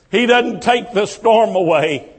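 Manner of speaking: ordinary talk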